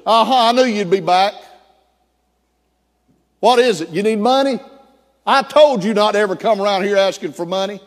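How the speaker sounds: a father scolding the son he expects wants money; stern father telling off his son; gruff father. Retold or imitated.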